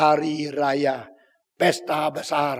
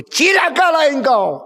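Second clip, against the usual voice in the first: high, loud condemning cry; John the Baptist cursing Herod; prophet's curse; 11 semitones higher, 8 dB louder than usual. Acted. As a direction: pronounce doom on the king. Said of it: "Ini Yohanes Pembaptis".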